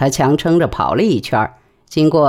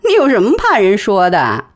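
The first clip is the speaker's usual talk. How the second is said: high teasing challenge; 7 semitones higher than usual. A narrator's reading. Wife teases her nervous husband, high and mocking. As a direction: needle him for being nervous.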